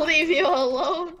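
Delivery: usual speaking voice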